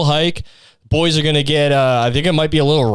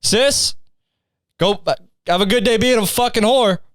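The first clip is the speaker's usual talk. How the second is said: cheery insults; 6 semitones higher than usual. Acted. Voicing cheerful family insults.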